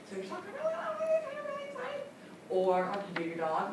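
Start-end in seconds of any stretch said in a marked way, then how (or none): 0.6-2.5 very high-pitched cooing "oh"s; tone demo. Shown as a demonstration. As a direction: demonstrate an exaggerated high tone. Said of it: "Your tone is thirty-eight percent".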